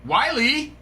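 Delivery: usual speaking voice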